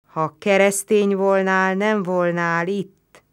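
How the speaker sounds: wary, doubting reply; suspicious. A narrator's reading. Roman suspicious of the narrator's faith. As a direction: let the doubt creep in. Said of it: "mondta gyanakodva".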